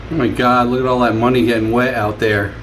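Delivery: ordinary delivery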